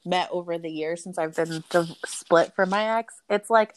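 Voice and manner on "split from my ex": usual speaking voice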